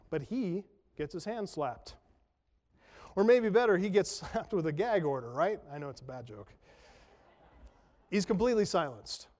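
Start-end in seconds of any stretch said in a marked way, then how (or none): none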